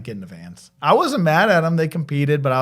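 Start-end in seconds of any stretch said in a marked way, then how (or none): 0.0-0.7 low, much softer, clipped command; curt order after a bitter loss; cold quiet order. Retold or imitated. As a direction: say it flat and cold to the team. Coach furious after loss.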